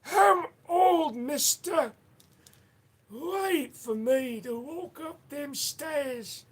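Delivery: put-on very high-pitched, drawn-out old butler voice; Jeeves the Butler. Old butler asking mister to wait, high creaky-slow voice. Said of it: "How does Jeeves the Butler sound?".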